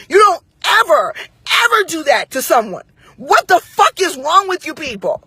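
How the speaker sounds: irate telling-off, pitched high; heated high-pitched scolding; going off. Retold or imitated. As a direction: relive chewing him out, full of outrage. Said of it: "when I went the entire fuck off".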